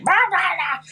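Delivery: high-pitched mock grumbling rant; comic outburst of an old complainer; ranting eighty-year-old. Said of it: "I'll be the eighty-year-old that's like".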